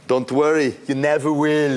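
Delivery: put-on Arnold Schwarzenegger accent. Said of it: "And Arnold would go".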